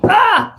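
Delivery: loud, high cry of alarm; startled yelp. Real, not acted. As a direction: yelp as the drink spills. She spills her drink.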